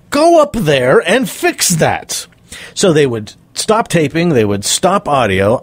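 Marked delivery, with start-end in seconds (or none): 0.1-2.3 higher, slow, drawn-out emphatic command; retold urgent order. Producer sends coach up to the host, insistent retold order. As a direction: act out an exasperated producer's order.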